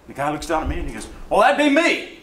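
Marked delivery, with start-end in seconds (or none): 1.3-2.2 high, loud, upbeat announcement; eager volunteering. Retold or imitated. Addict volunteering himself to the photographer, eager and high. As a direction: pipe up loudly, pitched way up. Acting out the willing addict's reply.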